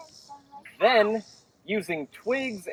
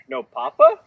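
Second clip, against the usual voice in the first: asking; 7 semitones higher, 7 dB louder than usual. Acted. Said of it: "she asked".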